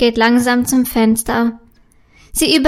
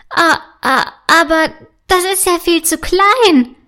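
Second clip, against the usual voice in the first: startled stammer; 5 semitones higher than usual. A narrator's reading. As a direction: gasp and stammer in fright. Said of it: "stottert Paula erschrocken". (erschrocken stotternd, höher und lauter)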